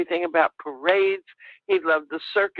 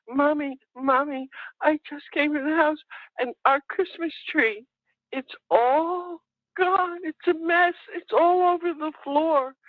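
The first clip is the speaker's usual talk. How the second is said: higher, upset little boy voice, mildly voiced; imitating crying little boy; 8 semitones higher than usual. Mother imitating her son crying on the phone, higher and upset. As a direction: retell his tearful call in his voice. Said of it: "He's crying on the phone".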